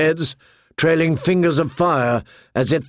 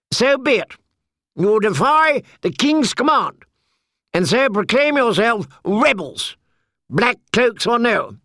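higher, cold proclamation; haughty knight; 9 semitones higher than usual. A narrator's reading. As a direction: pronounce them rebels. Sir Amory declares.

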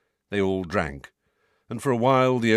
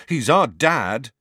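stubborn, slow and a bit louder; mulish; 6 semitones higher, 7 dB louder, slower than usual. A narrator's reading. Fred refusing Sirius, slow stubborn insistence. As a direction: dig in your heels, word by word. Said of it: "with a mulish expression".

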